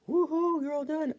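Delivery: squealing high and loud; cheery high voice. Retold or imitated. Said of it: "it's gonna say".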